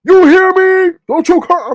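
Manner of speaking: imitating the streamer's loud, high angry voice; imitated angry voice. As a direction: mimic his angry yelling, high and loud. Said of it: "That's angry voice".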